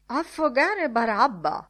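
shouted outburst. A narrator's reading. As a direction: cry it out, drawing out the words. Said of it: "urlò mister Dedalus".

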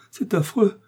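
high, strained accusation; agonised, high-pitched reproach; dying man's outcry. A narrator's reading. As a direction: cry out as a poisoned man accusing his killer. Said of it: "s'écria-t-il en apercevant D'Artagnan".

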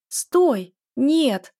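loud frightened cry; shouting. A narrator's reading. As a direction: yell it out in panic. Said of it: "закричала я".

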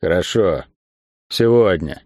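grudging, low-key concession; reluctant agreement, pitch dropped; forced out. A narrator's reading. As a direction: squeeze the words out reluctantly. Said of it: "выдавил из себя".

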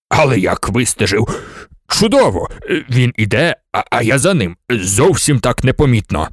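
a hint of a chuffed old man bragging; lightly voiced professor, pleased with himself; father boasting to his daughter about tailing a man; proud old professor. A narrator's reading.

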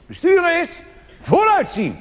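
high, loud proclaimed motto; mock slogan shout. Comedian mocks the politicians' motto, shouted. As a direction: bellow it like a pompous slogan.

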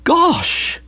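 very high, astonished exclamation; stunned cry. A narrator's reading. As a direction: cry out in amazement. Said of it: "cried Nobby, stunned to the core".

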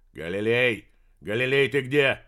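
loud call into a speaking tube; through a tube. Acted. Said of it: "схватил переговорную трубу".

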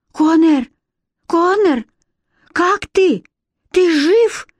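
high, anxious calls and questions; frightened calling. A narrator's reading. Alex calls to her fallen brother, frightened. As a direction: call out, scared he is hurt. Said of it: "Испугавшись не на шутку".

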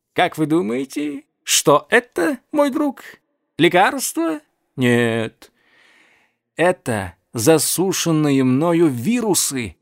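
high, gleeful announcement; joyful. A narrator's reading. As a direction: reveal it with glee. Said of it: "радостно сообщил он".

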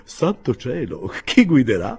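high, alarmed outburst; dismayed exclamation. A narrator's reading. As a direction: exclaim it in sudden concern. Pickwick suddenly worries who drives. (esclamazione allarmata)

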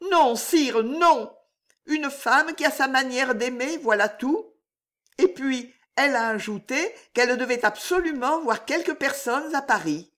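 a hint of a young woman in love; Juliette's voice.

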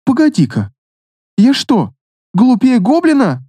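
high, rising outraged disbelief; indignant surprise. Acted. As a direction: catch on and bristle. Queen realizes insult, indignant.

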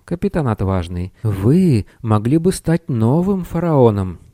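high-pitched pleading little wizard; timid adviser pleading his case; protesting little wizard. A narrator's reading. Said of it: "Маленький волшебник пытался протестовать".

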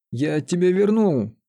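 exploding. A narrator's reading. Sorcerer defends himself to Лиза, exploding. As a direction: let acted temper stretch the words. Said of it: "взорвался чародей".

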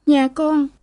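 high, plaintive tearful voice; acted tearful daughter. A narrator's reading. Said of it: "Cô Lễ lau nước mắt".

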